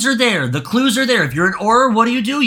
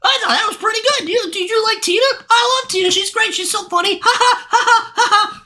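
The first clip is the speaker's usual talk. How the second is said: very high airhead voice with fake giggles; parodying happy viewers gushing about Tina; mock moviegoer; 14 semitones higher, faster than usual. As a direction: ham up a clueless fan gushing. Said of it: "and were like".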